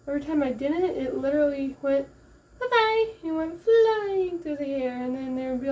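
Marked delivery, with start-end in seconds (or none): 2.6-3.1 singsong cap goodbye. Retold or imitated. Cap flying off her head.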